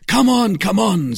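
high, loud hurry-up call; urgent urging. Acted. D'Artagnan urging his friends on, loud and urgent. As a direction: spur them on.

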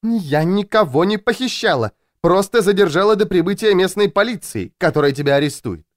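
high, insistent woman's voice; defensive Louisa. A narrator's reading. Louisa defends herself to her captive, indignant.

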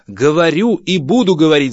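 high, very loud defiant shouting; wife shouting the husband down; angry shout. Acted. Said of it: "закричала жена". (гневный крик жены)

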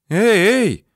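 high, loud call to stop; high narrated yell after someone; shouting. A narrator's reading. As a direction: call out sharply to halt them. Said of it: "закричала Людмила".

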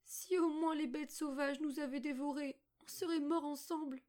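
put-on high, plaintive girl's lament; pleading. A narrator's reading. Margot despairs for herself and her brother, high pleading lament. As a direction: lament as a despairing little girl, voice held high. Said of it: "supplia-t-elle".

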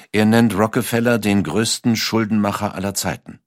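usual speaking voice

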